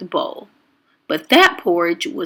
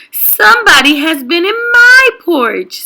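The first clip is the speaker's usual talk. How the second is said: indignant Mama Bear; 9 semitones higher, 7 dB louder, faster than usual. A narrator's reading. Mama Bear discovers porridge.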